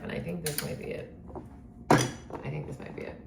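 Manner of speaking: breathy whispered worry; anxious whisper. Real, not acted. Wondering if she has anxiety, whispered quietly. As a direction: murmur it like a secret fear.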